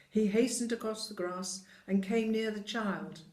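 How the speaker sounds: plain reading voice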